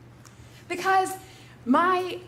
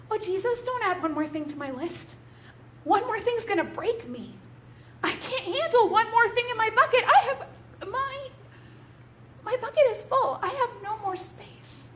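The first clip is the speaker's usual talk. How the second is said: higher, strained pleading voice; pitched up, near breaking with overload; overwhelmed plea; 7 semitones higher than usual. Acted. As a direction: beg not to be given more. Martha overwhelmed, begging Jesus.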